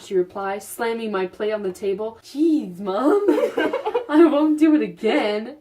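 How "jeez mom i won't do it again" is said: giggling while reading; laughing through lines. Acted. Teen answers mom, laughing.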